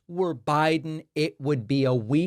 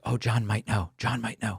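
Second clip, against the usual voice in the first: imitating a hushed deflection, softly; understated, quiet imitation of a reply; retold muttered aside; 4 semitones lower, 7 dB quieter than usual. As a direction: pass it on in a low, soft aside. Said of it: "And Hegseth is like".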